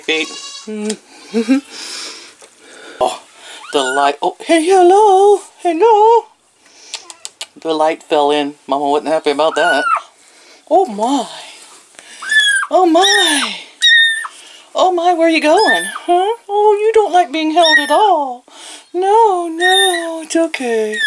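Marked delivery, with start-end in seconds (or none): 4.2-6.3 very high, louder, drawn-out cooing hello. Real, not acted. Greeting the chicks in baby talk. 10.7-21.0 high pet-talk coo. Real, not acted. Cooing at a squirming chick.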